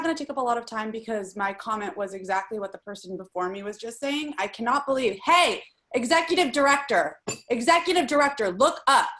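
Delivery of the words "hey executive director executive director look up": louder, sharp call to look up. Real, not acted. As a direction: call him out across the meeting. Calling out officials on call.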